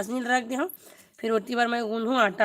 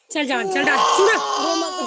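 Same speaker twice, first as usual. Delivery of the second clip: louder, firmly telling him to stop crying; loud scolding; 3 semitones higher, 7 dB louder than usual. Real, not acted. Mother loudly hushing her crying son at the stove.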